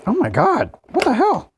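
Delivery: high, disbelieving outburst; shocked exclamation. Real, not acted. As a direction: react to the stuck lid. Container lid stuck fast.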